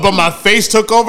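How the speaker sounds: normal talking voice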